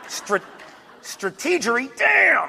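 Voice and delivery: high, exasperated groan at the TV; exasperated viewer. Retold or imitated. Viewers react to president's gaffe, exasperated high groan.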